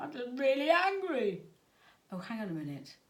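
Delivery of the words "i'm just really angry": put-on higher, louder angry child's voice; sulky child. Boy tells parent why, as a loud grumpy child. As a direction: grumble it like a cross little boy.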